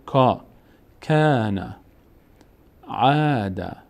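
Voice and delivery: کا and کان with a long, held aa; long alef sound. Shown as a demonstration. Teacher spells out kaf plus alef, long drawn-out vowel. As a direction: draw out the vowel in کان and عاد. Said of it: "بعدش الف اومده".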